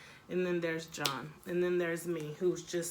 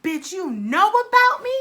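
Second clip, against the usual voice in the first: loud, high confrontational yell; loud high furious challenge; angry yell; 10 semitones higher, 13 dB louder than usual. Retold or imitated. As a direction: bark it at her in fury. Confronting the girl.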